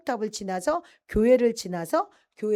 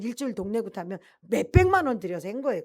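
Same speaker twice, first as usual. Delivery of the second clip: normal talking voice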